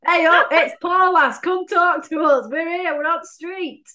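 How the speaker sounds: high, loud pretend street call-out; mock loudspeaker announcement. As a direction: announce yourselves to the whole street from the car. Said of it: "get one of them, like, speaker things on top".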